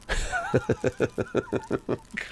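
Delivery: long, soft breathy chuckle; quiet chuckle. Real, not acted. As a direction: chuckle softly to yourself. Laughs at his own lofty wording.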